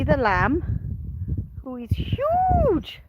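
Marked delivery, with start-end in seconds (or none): 1.6-3.0 much higher, slow marvelling; gushing. Real, not acted. Farmer shows off her big lamb, high slow gush. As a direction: stretch it out in awe.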